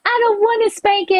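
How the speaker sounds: put-on high whiny child voice; little son. Son dreading a spanking, high whiny child voice. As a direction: whine it like a scared four-year-old. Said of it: "He goes".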